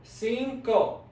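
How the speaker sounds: cinco drawn out in two parts; cinco broken syllable by syllable; slow syllables. Shown as a demonstration. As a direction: say cinco slowly, "cin", pause, "co". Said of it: "Say it slowly".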